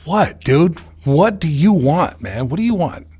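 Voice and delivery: answering his neighbour in mock annoyance; irritated reply. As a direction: snap back at a pest.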